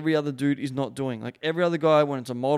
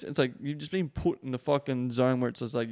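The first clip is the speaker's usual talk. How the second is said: normal talking voice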